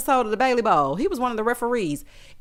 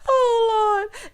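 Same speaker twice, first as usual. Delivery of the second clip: high cry of laughter fading; crying from laughing; laughing tears; 13 semitones higher, 4 dB louder than usual. Real, not acted. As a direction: gasp it out through laughter. Recovering from laughing fit.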